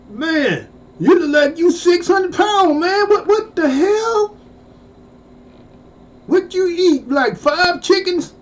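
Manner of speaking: high, teasing interrogation; mocking disbelief. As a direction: rib him like you can't believe it. Mock-interrogating obese classmate.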